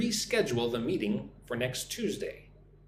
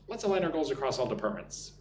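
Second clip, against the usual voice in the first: quick, connected run through the sentence; natural-speed take; faster than usual. Shown as a demonstration. Teacher repeating the sentence at speed, quick and linked. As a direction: say it fast in one smooth go. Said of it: "Listen and repeat after me".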